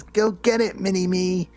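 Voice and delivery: a hammed-up onlooker cheering; mock cheering. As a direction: cheer him on in a giddy mock voice. Retold Waters cheering.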